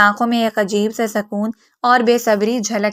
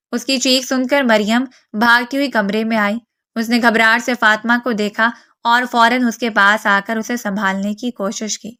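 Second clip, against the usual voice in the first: plain reading voice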